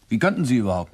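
ordinary delivery

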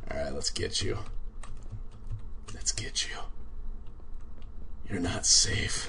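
low, near-whispered menace; playful hushed threat; hushed stalking. Pretend. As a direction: creep up quietly on your prey. Stalking a player in game.

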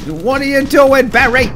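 high and loud, fed up; loud, high exasperation; exasperated yell. Real, not acted. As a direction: call out the teammate. Teammate acting up.

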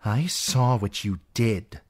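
cold and knowing; an aunt accusing her niece; Lady Lysa. Acted. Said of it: "the Lady Lysa said".

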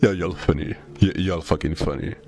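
ordinary delivery

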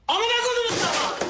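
explosive high, loud yell; furious shout. Acted. Cursing his crewmates after the fight, furious shout. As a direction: burst out screaming the curse.

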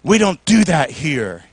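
soft, low shushing; hushing. Acted. Churchgoers silencing the shouting man, hushed. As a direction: shush them quietly and disapprovingly. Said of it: "People would say".

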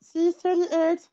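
high, slow squeaky voice. Shown as a demonstration. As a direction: squeak every word out. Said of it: "What a squeaky voice".

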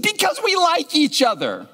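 put-on very high, simple child's answer; little girl voice. Girl explaining the drawing to the pastor, high and childlike. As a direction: say it as the most obvious thing. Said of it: "And she said".

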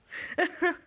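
quick high laugh; a little sheepish laugh; bright short laugh. Real, not acted. Laughs about buying only books.

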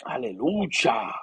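drawn-out, hushed hallelujah; slow reverent hallelujah. Real, not acted. Preacher lingering in God's presence, slow and reverent. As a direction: savour the word in awe. Quiet worship moment.

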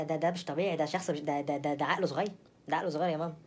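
normal talking voice

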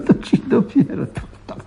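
chuckling through the words; amused laugh at the fainted boss. Acted. Prankster laughing at the fainted commissioner, chuckling.

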